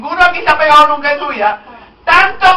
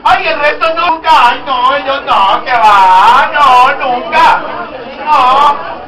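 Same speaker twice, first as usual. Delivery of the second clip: loud, drawn-out "no, not me" in mock innocence; mock denial; 7 dB louder, much slower than usual. Preacher mocking the congregation's feigned innocence, loud and drawn out. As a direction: ham up a churchgoer's innocent denial. Mocking churchgoers denying drinking.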